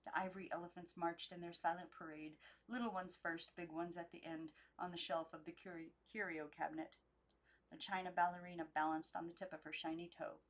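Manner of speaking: ordinary delivery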